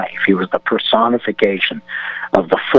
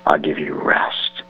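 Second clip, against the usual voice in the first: breathy whisper, softer and lower; whispered call; 7 semitones lower, 5 dB quieter than usual. Acted. Jesus softly calling lost listeners home, whispered.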